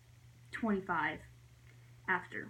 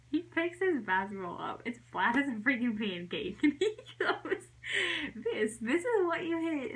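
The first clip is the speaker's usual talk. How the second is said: giggly, higher-pitched telling; laughing while talking; 5 semitones higher than usual. Real, not acted. Telling how brother found the flat ball, laughing. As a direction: fight through giggles to finish.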